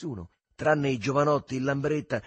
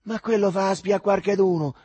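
loud, higher-pitched alarm; frightened voice; 7 semitones higher, 9 dB louder than usual. A narrator's reading. Alduccio losing all his confidence, frightened outburst. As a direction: blurt it out in sudden alarm. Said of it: "con voce spaventata".

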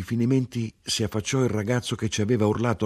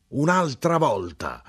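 higher, slow outraged cry; exasperated shout; 8 semitones higher, slower than usual. A narrator's reading. The grinder finds a ticket on his wheel, exasperated cry. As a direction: cry out at yet another fine. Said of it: "urlò".

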